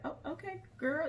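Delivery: high, soft, placating voice; soothing friend. Retold or imitated. Friend rubbing a crying woman's back, soothing and placating. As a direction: back off gently in a hushed high pitch. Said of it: "she was just rubbing her".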